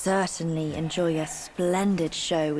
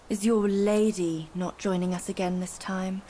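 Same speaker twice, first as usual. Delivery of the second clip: ordinary talk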